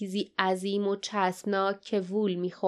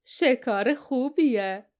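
slow, drawn-out growly character voice; menacing slow growl; growling grumble; 3 semitones higher, 4 dB louder, much slower than usual. A narrator's reading. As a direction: growl it out slowly, pitch lurching. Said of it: "فین غرغر کرد".

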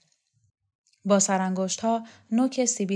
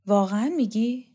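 ordinary delivery

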